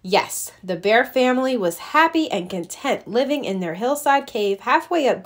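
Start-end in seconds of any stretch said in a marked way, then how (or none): none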